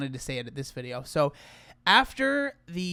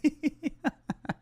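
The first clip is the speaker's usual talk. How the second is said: short high giggle at himself; a self-amused laugh; 10 semitones higher than usual. Real, not acted. Laughs at own comment.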